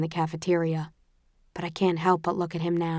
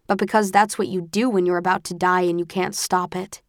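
ordinary delivery, nothing marked

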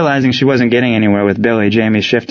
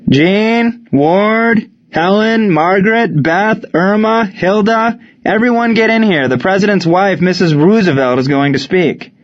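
calling out; 10 semitones higher than usual. A narrator's reading. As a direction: call the children in from across the house. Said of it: "Allie called out".

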